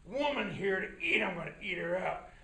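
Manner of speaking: low, greedy wolf telling his plan; low wolf voice, hungry and sly. Acted. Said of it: "And he said".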